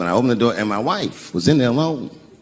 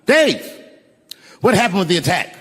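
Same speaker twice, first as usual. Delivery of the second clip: higher-pitched heckler's shout, loudest on the name; retold audience scream; 7 semitones higher than usual. Audience member calling out to the comic about the attack, mock scream. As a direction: imitate someone yelling from the crowd. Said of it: "someone in the audience screamed out".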